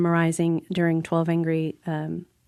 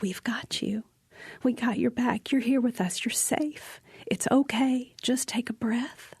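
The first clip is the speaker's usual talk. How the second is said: soft, higher-pitched voice of comfort; gentle reassurance; 5 semitones higher, 5 dB quieter than usual. Retold or imitated. Circle comforting a panicked castmate, soft reassurance. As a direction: soothe someone gently, voice light and hushed. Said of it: "Everyone was like".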